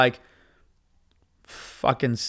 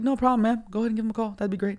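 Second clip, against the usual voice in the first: fake polite; 6 semitones higher, 4 dB quieter, faster than usual. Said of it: "I did it really, like, maturely".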